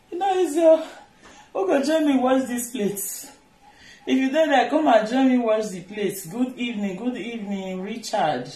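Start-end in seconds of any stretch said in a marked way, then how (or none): 0.1-6.3 laughing high through the words. Real, not acted. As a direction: laugh while calling out. Laughing while calling for help.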